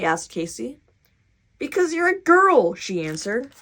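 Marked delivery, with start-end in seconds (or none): 1.6-2.8 put-on insistent kid. A narrator's reading. Girl insists to Casey.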